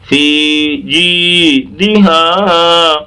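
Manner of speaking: slow, sustained chanting of فی جی دہا; drawn-out recitation. Shown as a demonstration. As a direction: hold each long vowel in فی جی دہا. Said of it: "اس طرح سے".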